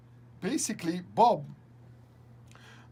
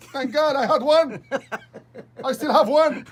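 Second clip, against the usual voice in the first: higher and much louder, gleeful; excited; 7 semitones higher, 12 dB louder than usual. Real, not acted. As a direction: crow about the trick, thrilled. Excited retelling of trick.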